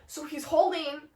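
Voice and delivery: ordinary delivery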